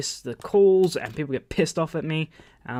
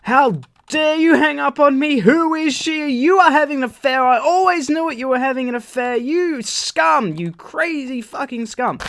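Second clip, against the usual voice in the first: much higher, hammed-up hysterical rant; losing her mind; 10 semitones higher, 5 dB louder than usual. Girlfriend raging after being hung up on, hysterical. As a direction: screech it as an outraged girlfriend impression. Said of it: "she's losing her mind".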